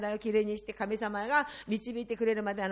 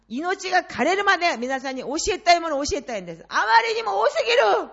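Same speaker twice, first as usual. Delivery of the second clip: 1 impassioned preaching; 6 semitones higher, 8 dB louder than usual. Real, not acted. Preacher swells with passion.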